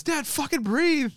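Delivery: put-on high, slow whiny kid voice; drawn-out high kid's whine; kid's exasperated plea. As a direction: plead like a fed-up kid begging his dad. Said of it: "even the kid by the end goes".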